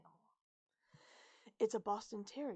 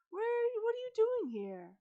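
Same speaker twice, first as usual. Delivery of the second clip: high, loud pet talk; cooing to a dog; 10 dB louder than usual. A narrator's reading. Travis to the small dog he scooped up, cooing. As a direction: coo at it in a high voice.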